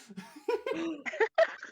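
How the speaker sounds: high giggle. Real, not acted. GM laughing.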